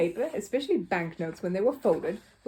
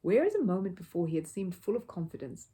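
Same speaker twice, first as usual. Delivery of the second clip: plain reading voice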